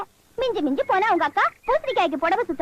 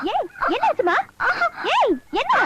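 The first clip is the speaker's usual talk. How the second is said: high anxious calls; worried calling; 7 semitones higher than usual. Acted. Woman asks the crying girl what happened, high anxious calls. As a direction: call out, alarmed, asking what happened.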